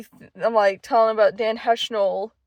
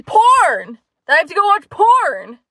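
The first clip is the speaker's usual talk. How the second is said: retold yell; 6 semitones higher, 7 dB louder than usual. Boyfriend yelling in the car.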